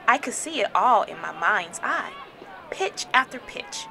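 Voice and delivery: plain reading voice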